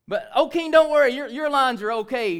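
high, very loud call to the king; Daniel. Acted. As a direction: holler good news up out of the den. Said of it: "it sounds like this".

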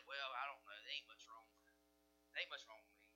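low, soft, hesitant mumble; unsure shrug. Pretend. Blind men giving Jesus a low, soft, lukewarm answer. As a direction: brush it off as if nothing's wrong.

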